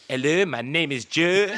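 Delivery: loud, slow, confident mock French accent; hammed-up French accent; fake French accent. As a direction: kid proudly faking French with English words. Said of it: "Go on then, speak French".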